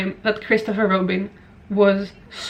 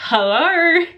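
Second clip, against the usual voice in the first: normal talking voice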